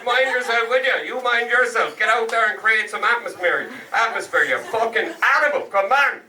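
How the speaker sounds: high-pitched, chummy Irish clerk; hearty post office clerk. Acted. Clerk sending Jimmy off with his money, high and hearty. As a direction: play a clerk chivvying a local character along.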